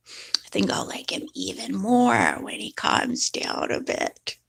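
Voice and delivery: whispered confession; hushed guilty aside. Real, not acted. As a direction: whisper it so the cat won't hear. Guilty admission about cat.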